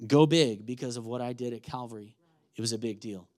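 ordinary talk

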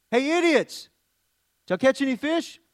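mock shout. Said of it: "I probably would have shouted out".

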